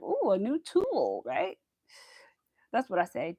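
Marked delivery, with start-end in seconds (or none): 0.0-1.2 high, slow, mock-delighted ooh; excited husband. Imitating her husband excited over a new tool. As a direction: gush over the gadget like him. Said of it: "He's always like".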